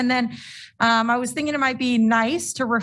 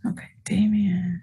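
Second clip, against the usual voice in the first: whispering; 3 semitones lower than usual. Real, not acted. Aside during meeting setup.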